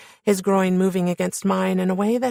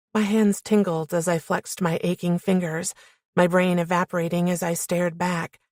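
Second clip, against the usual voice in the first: ordinary delivery, nothing marked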